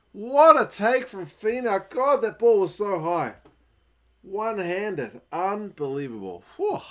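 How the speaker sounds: loud, high, awestruck sports reaction; excited amazement. Real, not acted. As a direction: exclaim it, thrilled by the play. Marvelling at the catch.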